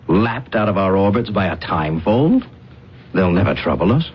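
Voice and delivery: normal talking voice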